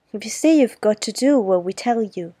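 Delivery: put-on loud, bossy boy's warning; a boy warning his pal's sister to obey. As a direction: lay down the law loudly. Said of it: "Deal warned".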